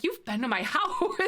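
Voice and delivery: laughing through the words in disbelief; can't hold the giggle while saying it. Retold or imitated. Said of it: "I was like".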